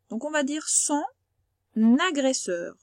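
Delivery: son agresseur, with the N run into agresseur; liaison demo. Shown as a demonstration. Teacher showing the liaison to a pupil, carefully. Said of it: "on fait comme s'il y avait un deuxième N".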